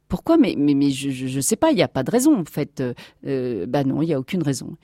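halting, hesitant search for a reason; flustered stammering. Acted. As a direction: stumble over words, unsure. Captain has no answer.

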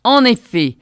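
loud, slow furious roar; yelling. A narrator's reading. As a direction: roar it down at her. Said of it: "hurla l'obèse".

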